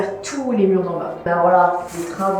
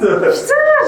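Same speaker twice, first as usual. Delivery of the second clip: frightened cry; 4 dB louder than usual. Real, not acted. Scared on the ladder.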